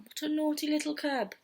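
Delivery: usual speaking voice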